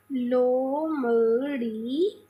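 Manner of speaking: लोमड़ी drawn out very slowly, rising at the end; slow demo word. Shown as a demonstration. Teacher stretching लोमड़ी out slowly for her pupils. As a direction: stretch लोमड़ी out slowly so children can follow. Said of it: "अभी मैंने बताया".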